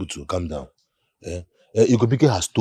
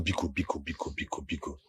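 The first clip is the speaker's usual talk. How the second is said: soft begging; 5 semitones lower, 12 dB quieter than usual. Acted. Begging angry elder softly.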